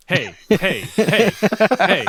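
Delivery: robotic monotone dog collar voice; monotone robot hey, repeated. Shown as a demonstration. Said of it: "in, like, a monotone voice".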